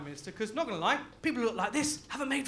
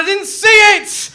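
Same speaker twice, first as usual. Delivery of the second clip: loud, higher, slow indignant yell; retold shout; 9 semitones higher, 16 dB louder, slower than usual. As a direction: bellow it back at the caller. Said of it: "I said".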